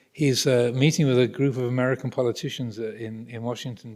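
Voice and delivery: ordinary delivery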